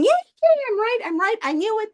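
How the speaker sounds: high, giddy, triumphant squeals; gleeful gloating. Retold or imitated. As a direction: crow it out like a smug child who won. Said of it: "donkey goes skipping along, going".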